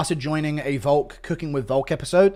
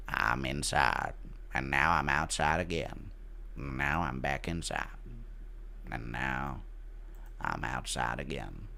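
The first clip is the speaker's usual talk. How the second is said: very low, slow, soft narrator voice; deep, measured narration with long pauses; Morgan Freeman; 13 semitones lower, 13 dB quieter, slower than usual. Acted. Narrating being caught in a revolving door, deep slow impression. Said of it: "Impression of Morgan Friedman caught in a revolving door".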